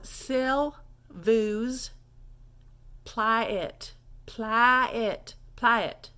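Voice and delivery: "s'il vous" very slow with gaps; word-by-word French demo. Shown as a demonstration. Teacher drilling a harder phrase, slow word-by-word demo. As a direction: break up "s'il vous", then repeat "plaît" as plah-it. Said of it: "that one's a little bit harder".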